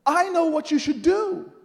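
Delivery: loud, high proclamation; emphatic announcement. Retold or imitated. Miss Jones announcing her insight to him, emphatic. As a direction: announce it like a great revelation.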